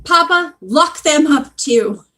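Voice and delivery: loud, high, indignant order; hammed-up, drawn-out outcry; shrieking woman. A narrator's reading. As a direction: shriek the command as an outraged shopkeeper. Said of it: "the woman shrieked".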